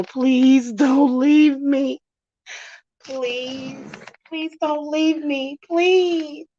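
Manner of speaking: high, tearful pleading voice; begging. Retold or imitated. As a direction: plead desperately, voice up high and crying. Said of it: "You're supposed to beg and go".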